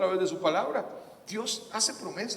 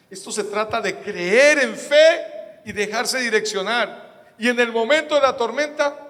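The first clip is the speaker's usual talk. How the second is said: slow, louder and higher push on creer en fe; emphatic preaching; 7 semitones higher, 8 dB louder than usual. Real, not acted. (predicando con énfasis, lento y fuerte)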